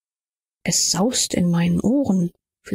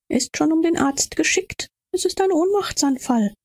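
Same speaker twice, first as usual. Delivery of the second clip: higher-pitched acted figure; high voice of an onlooker; bystander's voice; 8 semitones higher than usual. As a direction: report it in a high, urgent character voice. Bystander reassures the distressed aunt.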